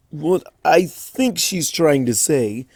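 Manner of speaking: slow, dry explaining voice; young dragon Spike. Acted. Spike explaining for Pinkie, dry young dragon voice. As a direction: spell it out patiently.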